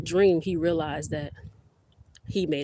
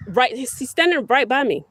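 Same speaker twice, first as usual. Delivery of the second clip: loud, raised-pitch holler; calling out; 7 semitones higher, 9 dB louder than usual. Real, not acted. Reassuring daughter about the lost dog, calling out. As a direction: call it over to someone at a distance.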